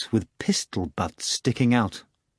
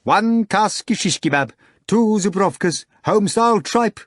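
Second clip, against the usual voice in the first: high, raised kitchen order announcement; loudspeaker command; 8 semitones higher, 3 dB louder than usual. A narrator's reading. Voice commanding the kitchen through a loudspeaker, high announcing tone. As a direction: call out orders as if over a tannoy. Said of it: "a voice commanded through a loudspeaker".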